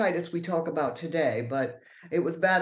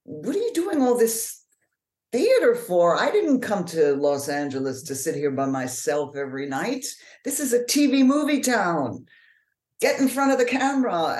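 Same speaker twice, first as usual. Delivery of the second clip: higher, louder exasperated husband; husband complaining; 4 semitones higher, 4 dB louder than usual. Retold or imitated. Husband pushing his wife toward screen acting, exasperated. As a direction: act out a fed-up husband's demand. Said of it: "one day my husband said".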